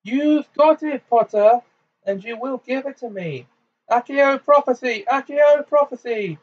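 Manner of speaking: very high, frantic demand; shrill-edged fury with panic behind it; anger over terror. A narrator's reading. Bellatrix tries to summon the prophecy, angry and terrified. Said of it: "the terror behind her anger".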